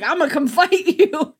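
goofy eager fighter breaking into a laugh; Morph quote. Retold or imitated. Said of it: "Morph's like".

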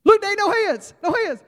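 put-on very high, loud kid holler; gleeful child shouting from a ride; excited little boy. Boy showing off to Dad from the coaster, thrilled.

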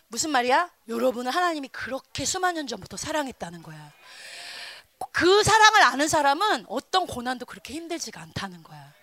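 usual speaking voice

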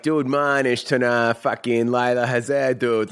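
put-on broad Masshole accent; Boston accent. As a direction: talk like a rowdy Boston local. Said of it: "kinda doing our fucking mass hole accents".

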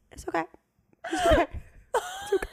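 mock reassurance. Naked client telling the technician it's fine, in squeaky mock reassurance. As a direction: squeak out the reassurance in broken little bursts.

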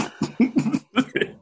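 short high stifled giggle; a giggle slips out; stifled laugh. Real, not acted. Laughing at golfer's collapse.

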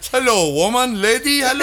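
put-on high, loud comic Indian accent; bad Indian accent. Indian dad hailing a stranger, hammed-up Indian accent. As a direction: call out insistently in a mock Indian accent. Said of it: "my Indian accent is off".

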